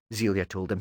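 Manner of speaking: plain reading voice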